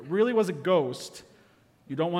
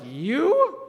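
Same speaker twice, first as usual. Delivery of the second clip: high, loud shocked "You?"; disbelieving old friend; incredulous; 9 semitones higher, 6 dB louder than usual. Retold or imitated. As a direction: gasp it out in disbelief. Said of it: "they were like".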